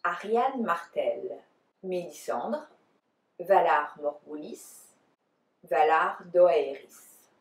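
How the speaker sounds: names pronounced in a French accent. Shown as a demonstration. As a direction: say each name the French way. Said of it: "try to do it the French way".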